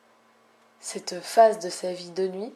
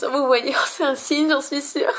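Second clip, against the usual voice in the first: higher and louder, thrilled; excited; 6 semitones higher, 6 dB louder than usual. Real, not acted. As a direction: burst out with delight. Excited by the trait.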